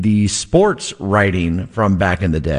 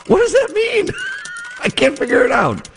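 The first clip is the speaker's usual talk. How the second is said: laughing bafflement. Real, not acted. Host baffled by the old prose.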